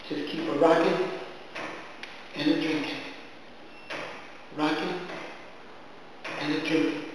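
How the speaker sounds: rocking and drinking refrain fading out. A narrator's reading. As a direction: let the chant die away. Refrain describing the master drinking.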